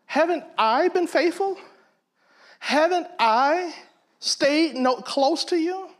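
self-righteous whine. Retold or imitated. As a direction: whine about your own faithfulness. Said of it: "Sounding kinda like us".

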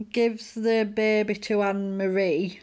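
normal talking voice

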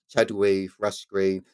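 ordinary talk, nothing marked

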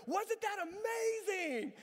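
high, slow, wide-eyed delight; big brother hyping the scary ride; gushing question. Retold or imitated. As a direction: sell it as the best ride ever. Asking brother after landing.

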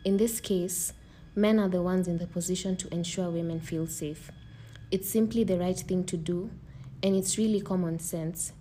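earnest scripted statement; formal speech. Acted. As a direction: read it like a public announcement. Reading a prepared message.

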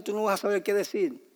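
usual speaking voice

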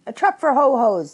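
high, loud, husky outburst; husky Piglet. A narrator's reading. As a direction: blurt it huskily as a flustered Piglet. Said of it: "said Piglet huskily".

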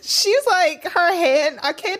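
high voice through real laughter; laughing. Real, not acted. Describing the co-host's hand gestures, high and laughing. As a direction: try to talk while cracking up.

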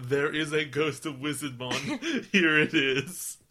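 slow, laughing title read. Real, not acted.

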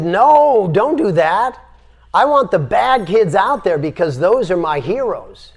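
teacher forbidding the cells, loud and insistent; emphatic command. Retold or imitated. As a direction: stop them firmly, then praise the rebels.

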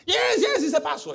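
high, loud excited yes; eager mock confirmation. Relative confirming to the prophet, high and excited.

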